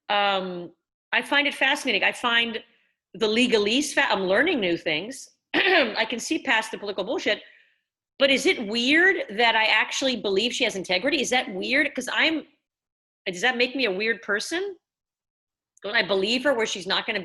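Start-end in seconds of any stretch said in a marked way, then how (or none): none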